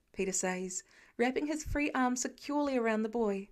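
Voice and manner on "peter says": usual speaking voice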